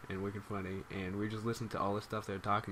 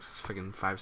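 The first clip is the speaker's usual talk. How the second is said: usual speaking voice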